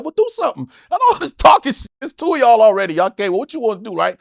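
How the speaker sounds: high, loud taunting dare; goading two men to swing first; daring them. Retold or imitated. Comic to two men outside the club, high taunting dare. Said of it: "So I'm just kinda, like, saying shit".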